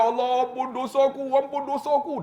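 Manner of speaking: high-pitched mock crying prayer; imitated tearful wailing. Repentant pilgrim crying out to God, imitated wail. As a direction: plead in a high, wailing voice, hammed up. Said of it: "Dia teriak".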